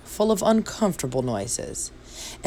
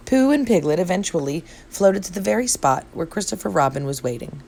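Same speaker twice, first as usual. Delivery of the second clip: ordinary delivery, nothing marked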